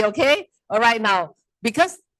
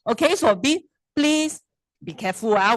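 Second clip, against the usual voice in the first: ordinary delivery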